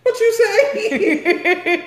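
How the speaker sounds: high, loud, slow laughing demand; laughing mock shout. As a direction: bellow it through laughter at the kid. Mother reacting to son's curse.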